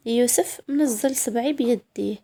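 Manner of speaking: usual speaking voice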